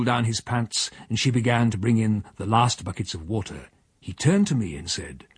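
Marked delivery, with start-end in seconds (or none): none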